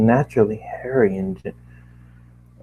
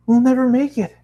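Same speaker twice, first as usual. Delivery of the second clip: frightened Twilight; 14 semitones higher, 4 dB louder than usual. Acted.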